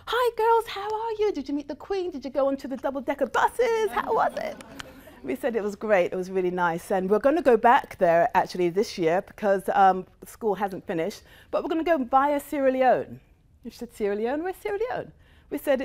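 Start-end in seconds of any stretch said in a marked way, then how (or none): 0.1-4.6 put-on much higher, chirpy girlish greeting; mock-bubbly friend with high sing-song questions; bubbly American friend. Imitating chirpy American best friend. 13.9-15.1 puzzled friend. Retold or imitated. Friend puzzled by Sierra Leone.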